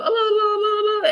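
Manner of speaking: put-on very high playful child's oh's; little boy cheering. Medium shows the boy jumping and stomping, high childlike oh's. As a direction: squeal it out like a happy toddler. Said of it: "he can go".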